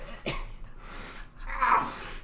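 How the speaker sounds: high coughing laughter; coughing fit. Real, not acted.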